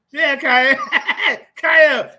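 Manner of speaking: laughing. Real, not acted. Laughing greeting a caller.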